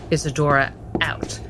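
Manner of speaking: usual speaking voice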